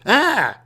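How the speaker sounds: high, loud shared shriek; both scream in fright together; joint scream. Retold or imitated. Two strangers seeing aliens first time, screaming. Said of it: "we both scream".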